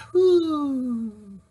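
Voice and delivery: high, relieved whew; winded whew after a rant. Real, not acted. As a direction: blow out a whew to cool off. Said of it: "Got a little excited this morning".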